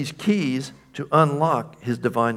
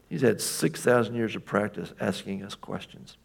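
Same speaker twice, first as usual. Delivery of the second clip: ordinary talk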